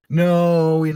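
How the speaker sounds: sharp loud no. Real, not acted. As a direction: cut it off loudly. Sudden loud correction.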